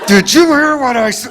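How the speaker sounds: mock mom scolding through a stiff mouth, pitch climbing; ventriloquist mom, rising. Mom in public scolding her kid, ventriloquist style. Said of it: "you do that ventriloquist thing".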